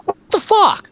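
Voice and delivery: a high, disbelieving cry; can't believe he went to work; incredulous. Real, not acted. Podcast host baffled by the movie, incredulous.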